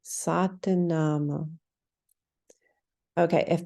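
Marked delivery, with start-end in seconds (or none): 0.0-1.5 slow "Satanama" with a long A in the middle; Satanama slowly. Shown as a demonstration. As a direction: lay out "Satanama" evenly, syllable by syllable. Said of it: "The little dash underneath".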